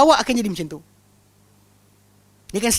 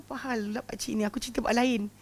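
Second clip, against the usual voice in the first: much softer, bewildered inner thought; puzzled aside; 10 dB quieter than usual. Retold or imitated. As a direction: mutter it to yourself, baffled. Puzzled inner thought at crying man. (bisikan hati yang hairan, jauh lebih perlahan)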